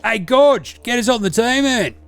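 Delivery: a brash, sweary ocker lad; Deli character. Acted.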